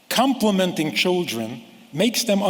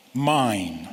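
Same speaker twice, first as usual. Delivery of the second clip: ordinary talk, nothing marked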